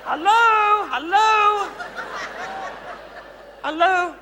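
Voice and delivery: a mock-sweet greeting shouted upward. Calling up to the gallery.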